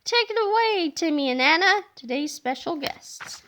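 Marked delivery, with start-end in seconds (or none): none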